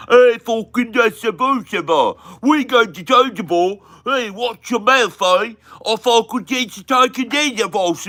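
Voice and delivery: high, loud, garbled Cockney mockery; thick Cockney accent. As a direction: shout an incomprehensible Cockney caricature. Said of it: "Like, thick accent".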